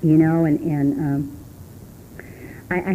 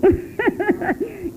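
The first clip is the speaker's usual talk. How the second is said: a burst of high laughter; real high laugh after a tease. Real, not acted. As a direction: laugh at her own joke. Laughs after teasing the men.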